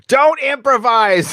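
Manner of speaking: high, drawn-out shout; mock scolding yell. Scolding the villain for botching his monologue, mock yell. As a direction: yell it like an exasperated director, stretching it out.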